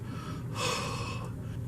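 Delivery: long, soft breathy sigh; swooning sigh. Real, not acted. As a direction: sigh dreamily over a crush. Swooning over a Scottish accent.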